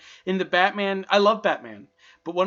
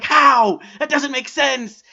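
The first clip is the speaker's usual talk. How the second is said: incredulous; 7 semitones higher, 9 dB louder, slower than usual. Retold or imitated. Mocking confused viewer.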